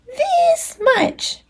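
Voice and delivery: very high, squeaky little hare; proud tiny bunny. Acted. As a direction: stretch the words out like tiny arms. Little Nut Brown Hare stretching arms.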